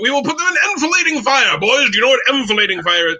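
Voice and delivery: high, commanding mock British officer accent; terrible British accent. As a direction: lecture the troops in plummy put-on British. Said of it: "I will do my terrible British accent".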